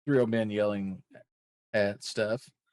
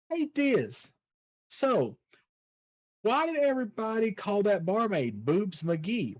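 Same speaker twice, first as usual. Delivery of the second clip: curious fan voice; 8 semitones higher than usual. Retold or imitated. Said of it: "questions like".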